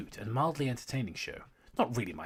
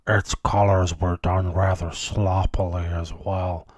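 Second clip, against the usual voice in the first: Doctor voice; 5 dB louder than usual. Acted. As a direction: read it like the Doctor, booming. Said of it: "I'm gonna have to talk in that voice now".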